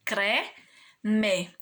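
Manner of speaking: "Creme" split into two held beats, cre, me; syllable by syllable. Shown as a demonstration. Teacher showing how "Creme" divides, syllable by syllable. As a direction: say "Creme" slowly, final "me" fully sounded. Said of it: "Olha o som do C e do R juntos".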